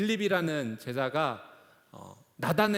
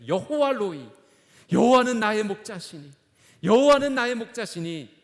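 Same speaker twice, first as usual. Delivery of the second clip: loud, high proclamation of faith; fervent confession; 6 semitones higher, 8 dB louder than usual. Shown as a demonstration. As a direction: declare it boldly to the congregation. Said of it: "우리는 이렇게 계속 고백할 수 있어야 돼요".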